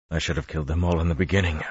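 fast, breathless ragged voice; panting. A narrator's reading. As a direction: spit it out between gasps. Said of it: "Lews Therin panted".

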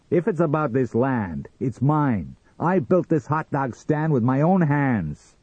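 high, loud, defiant claim; angry, possessive. Acted. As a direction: defend what's yours hotly. Sam defends his land.

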